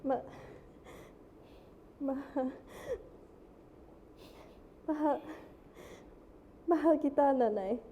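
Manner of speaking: halting, choked start, then a loud tearful "mahal kita"; tearful declaration. Acted. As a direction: fight through tears to say I love you. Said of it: "I don't know why I'm crying".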